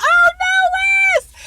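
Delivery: high, loud cry of grief over Wes; a high, loud shocked wail; anguished cry. Retold or imitated. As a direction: wail his name as if it just hit you. Discovering Wes is dead.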